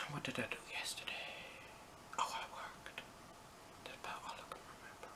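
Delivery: hushed whispered aside to herself. Real, not acted. Teen vlogger whispering an aside to herself. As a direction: drop to a secret whisper.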